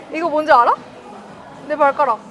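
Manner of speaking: teasing him with the hole in her shoe; playful loud tease. Real, not acted. Wife proudly shows toe. (장난스럽게 크게 자랑하듯)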